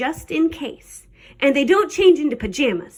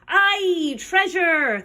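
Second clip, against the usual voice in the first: high, drawn pirate cheer; crew shout; 5 semitones higher, much slower than usual. A narrator's reading. Crew hears about the treasure chest, shouting. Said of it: "the others shouted".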